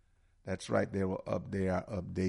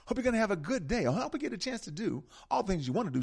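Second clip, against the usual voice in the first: ordinary talk, nothing marked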